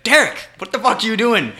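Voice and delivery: high, loud outraged yell; mock angry boss. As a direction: imitate a boss yelling at his employee. Boss yells at employee in retelling.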